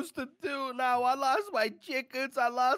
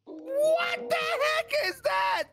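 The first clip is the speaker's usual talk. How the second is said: horrified scream; 11 semitones higher, slower than usual. Real, not acted. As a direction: shriek it out in horror. Sees his dog turned monstrous.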